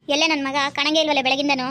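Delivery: loud, sharp mother-in-law scolding; mother-in-law yelling at her son's wife for coming home late; angry scolding. Acted. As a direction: bark at the late daughter-in-law. Mother-in-law demands her son.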